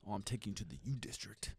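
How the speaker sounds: hushed whisper of the dad's secret plan; whispered quote. Retold or imitated.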